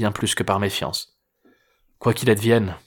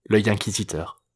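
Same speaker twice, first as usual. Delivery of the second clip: ordinary delivery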